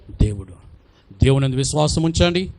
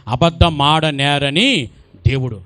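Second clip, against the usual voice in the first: ordinary talk, nothing marked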